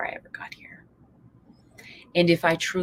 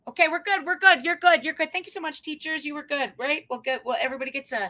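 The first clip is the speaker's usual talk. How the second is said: ordinary talk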